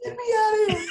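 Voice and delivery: very high, loud comic plea; hammed-up high-pitched shout; mock panicked yell. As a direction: squeal it out as if begging to escape. Joking what he would have yelled.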